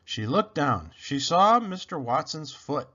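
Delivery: ordinary delivery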